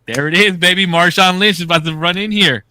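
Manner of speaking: loud, high excited cheering at the screen; celebrating a sure touchdown before it happens; hyped cheer. Retold or imitated. Friend cheering at the Super Bowl screen, loud and high.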